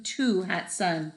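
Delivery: ordinary delivery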